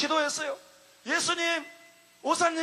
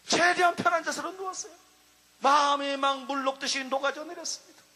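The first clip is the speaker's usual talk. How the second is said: normal talking voice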